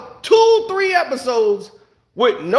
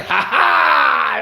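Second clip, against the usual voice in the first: mock gotcha shout. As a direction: bark out a gotcha laugh, low. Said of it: "make a guest appearance like".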